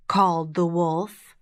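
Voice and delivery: plain reading voice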